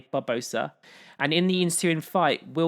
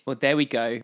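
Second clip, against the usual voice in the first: usual speaking voice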